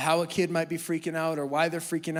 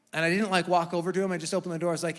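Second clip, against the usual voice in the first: normal talking voice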